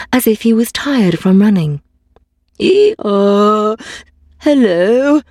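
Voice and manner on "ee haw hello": a donkey's hee-haw then a hello; braying donkey. A narrator's reading. Donkey greeting the tired dog with a bray. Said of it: "said the donkey".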